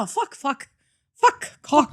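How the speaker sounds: lightly voiced starlet swearing in a blooper; a hint of 1950s glamour, cursing; glamorous old hollywood star. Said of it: "old movie bloopers".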